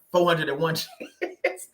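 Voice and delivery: cracking up mid-quote; laughing. Real, not acted. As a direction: break into a real laugh before the last word. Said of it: "I'm laughing, but it's the truth".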